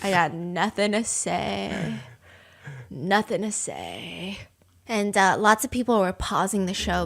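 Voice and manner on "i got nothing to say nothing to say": defeated murmur. Retold or imitated. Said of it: "she was just like".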